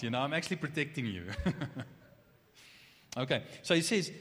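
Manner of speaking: usual speaking voice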